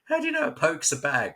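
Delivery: high, fast amazed question; retold surprise. Carolina locals amazed that a Brit knows poke. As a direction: ask it amazed, voice jumping up.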